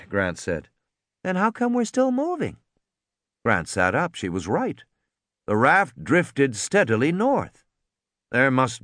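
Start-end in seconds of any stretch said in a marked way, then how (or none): 1.2-2.6 put-on high girl's voice; girl pointing out they still drift; curious girl. A narrator's reading. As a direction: ask it like a puzzled kid. Lex's girl voice.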